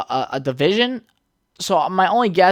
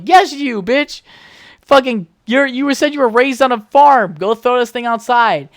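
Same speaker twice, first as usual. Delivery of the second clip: high, loud bossy retort; exasperated yelling; 8 semitones higher, 5 dB louder than usual. Retold or imitated. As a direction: snap back at your roommate in a panic. Acted quarrel over removing the mouse.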